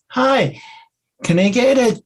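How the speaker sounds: high, slow, over-rolled R on "cheeseburger"; imitating the wife ordering at the drive-through; exaggerated R. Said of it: "I'm exaggerating the R".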